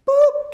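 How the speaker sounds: sudden high, loud "boop"; punchy vocal sound effect; imitated snap sound.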